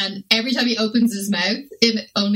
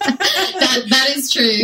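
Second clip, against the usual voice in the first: laughing agreement; 6 semitones higher, 6 dB louder, much slower than usual. Real, not acted. Laughing along as her friend jokes about needing captions. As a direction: laugh through the words, higher and louder.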